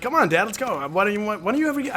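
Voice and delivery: put-on pleading kid. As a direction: nag Dad like an eager kid. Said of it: "I'd be like".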